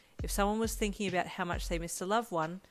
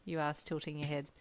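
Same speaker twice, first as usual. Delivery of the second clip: plain reading voice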